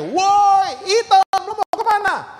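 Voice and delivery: very high, stretched-out holler; full-voiced mock schoolyard shout. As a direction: bellow the taunt with a long high "Woi". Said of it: "lebih kencang teriakannya".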